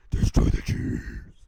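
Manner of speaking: slow, very low, loud rasped whisper; villainous growl. Acted. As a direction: growl it slowly like a cartoon villain. Repeating Haman's villain growl.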